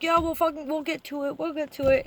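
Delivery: a light sarcastic imitation of office staff; mocking dismissive reply. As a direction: mimic the staff putting him off. Mocking repeated brush-off.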